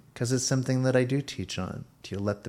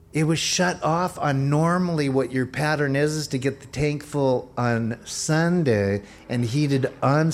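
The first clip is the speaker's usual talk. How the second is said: louder, rising preaching drive; fervent preaching; 3 semitones higher, 4 dB louder than usual. Acted. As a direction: push it like a sermon climax. Preaching at full force.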